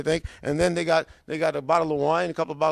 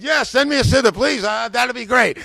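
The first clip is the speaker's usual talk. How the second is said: high, louder enthusiastic yes; eager acceptance; 6 semitones higher, 5 dB louder than usual. Retold or imitated. Customer ordering from the bookseller, excited. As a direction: leap at the offer.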